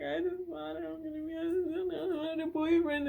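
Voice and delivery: high, sobbing mock lament; hammed-up sob-singing; mock crying wail. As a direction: wail it like a heartbroken kid. Retold crying after rejection.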